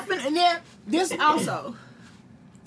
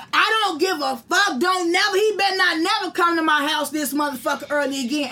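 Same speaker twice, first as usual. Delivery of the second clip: shouted so he hears through the door; loud on purpose. Retold or imitated. She yells through the locked door so the landlord hears. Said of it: "I said it loud like that".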